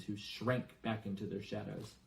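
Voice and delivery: ordinary delivery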